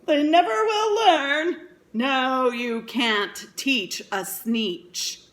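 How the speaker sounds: gloating con man. A narrator's reading. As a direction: sneer it out as you drive off. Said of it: "he laughed as he drove".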